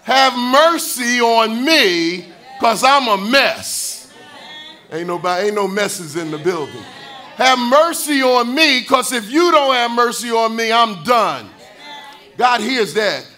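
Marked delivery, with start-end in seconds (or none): 0.0-4.1 loud, slow pleading prayer; desperate cry for mercy. Retold or imitated. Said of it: "the prayer that says". 7.4-11.5 desperate cry for mercy. Retold or imitated. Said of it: "the prayer that says".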